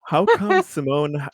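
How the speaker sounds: ordinary delivery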